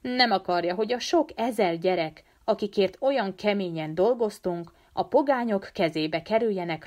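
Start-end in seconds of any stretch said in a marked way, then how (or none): none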